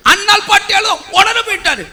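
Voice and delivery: high-pitched animated protest; indignant exclamation. Real, not acted. Preacher complaining God ran to weeping Hannah, high exclamation.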